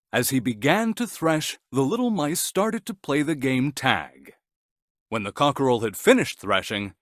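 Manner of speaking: usual speaking voice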